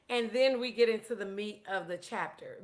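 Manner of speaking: ordinary delivery, nothing marked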